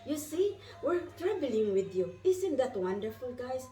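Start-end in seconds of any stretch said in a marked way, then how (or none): 0.0-2.1 high, lifted voice of the angels; bright angelic messengers calling her name; angels speaking. Acted. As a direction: sing out their greeting like heavenly voices. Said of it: "And they said".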